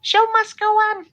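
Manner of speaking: very high, slow, loud puppet voice; Elmo. Shown as a demonstration. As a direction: uncertain attempt at a squeaky puppet. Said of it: "I don't know if that's Elmo".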